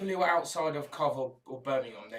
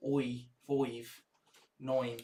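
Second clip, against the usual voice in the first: rough Cov vowels, "five" and "nine" toward "foive" and "noine"; Coventry accent; much slower than usual. Shown as a demonstration. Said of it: "you might say".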